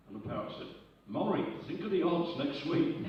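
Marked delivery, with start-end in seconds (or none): 1.1-3.0 higher, louder punchline comeback; exasperated retort. Acted. Parrot answers its furious owner, exasperated retort. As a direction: set the owner straight, raised pitch and volume.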